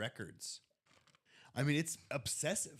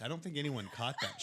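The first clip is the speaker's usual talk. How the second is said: normal talking voice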